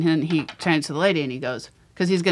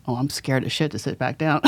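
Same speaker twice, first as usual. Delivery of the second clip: softer and lower through a laugh; laughing confession; 5 semitones lower, 4 dB quieter than usual. Retold or imitated. Man confides fear of returning seat, laughing softly. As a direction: admit it while chuckling. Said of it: "And he goes".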